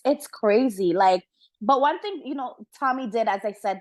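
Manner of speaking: usual speaking voice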